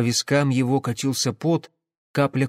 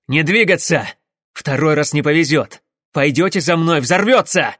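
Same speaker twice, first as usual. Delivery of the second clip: loud, raised warning; shouted threat; 3 semitones higher, 6 dB louder than usual. Acted. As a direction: hold them off with a threat. Engineer threatens while backing away. (угрожающий крик)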